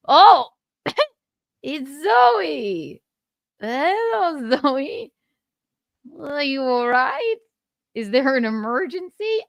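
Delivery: high, puzzled fake concern; mock startled winner. French winner to stage intruder, high mock concern. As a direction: greet her in hammed-up bewilderment.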